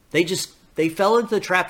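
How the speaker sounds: usual speaking voice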